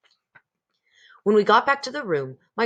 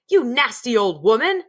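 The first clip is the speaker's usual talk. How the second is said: louder, sneering insult; angry; 5 semitones higher, 5 dB louder than usual. A narrator's reading. Mr Jenkins insulting the grandmother, angry and louder. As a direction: spit the insult at the old lady.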